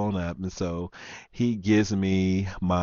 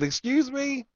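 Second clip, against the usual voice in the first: shocked, very high indignant squeak; indignant excuse me; 3 dB quieter than usual. Retold or imitated. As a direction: act outraged at the price, pitch shooting up. Retold indignant reply to cashier.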